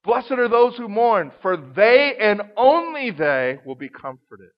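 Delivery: "they and only they" slowed and weighted; emphatic reading, "only" given the weight; stress on they and only they. Shown as a demonstration. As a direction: press "only" as the key word. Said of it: "we call it emphatic".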